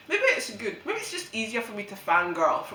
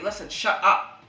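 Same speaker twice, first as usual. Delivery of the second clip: rude snap; 4 semitones lower, 4 dB louder than usual. Retold or imitated. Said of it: "I'm really rude about it".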